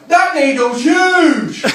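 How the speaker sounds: very loud, high, drawn-out shout; yell. Retold or imitated. Comedian winding up a scared blood donor, mischievous yell. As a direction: yell it slowly to alarm the whole room. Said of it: "what I do for my own amusement is yell".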